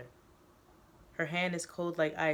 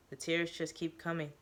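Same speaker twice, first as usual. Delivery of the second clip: plain reading voice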